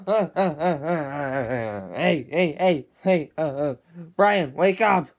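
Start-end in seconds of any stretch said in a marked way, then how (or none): none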